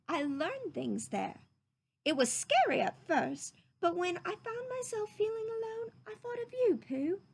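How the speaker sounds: put-on a boy opening his heart; tender boy voice. Christopher Robin confides to Pooh.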